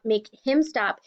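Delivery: usual speaking voice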